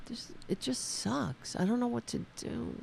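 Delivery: defeated. Real, not acted. Streamer at a loss over the broken show, quietly defeated. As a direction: let it out weakly, nearly whispering.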